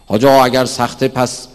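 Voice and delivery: loud, mock-knowing questioner; questioner's voice. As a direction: challenge the cleric with a clever point. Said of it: "دیدی گفتم یه اطلاعاتی داره".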